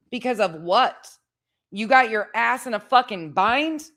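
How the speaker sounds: pissed off. Real, not acted. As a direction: chew them out in disbelief, swearing hard. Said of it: "Sorry, I'm pissed".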